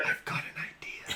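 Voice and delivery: breathy secret whisper. Acted. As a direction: whisper it like a scheme. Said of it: "and he whispers".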